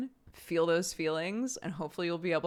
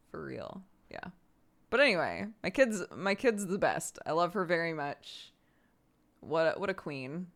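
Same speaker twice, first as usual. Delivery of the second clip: usual speaking voice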